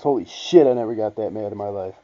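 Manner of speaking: ordinary talk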